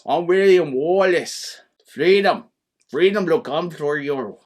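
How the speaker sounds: put-on slow, hammy Scottish accent. Playing William Wallace for the co-host, Scottish accent. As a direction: do a Scottish warrior, drawing the words out. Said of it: "That's my Scottish accent".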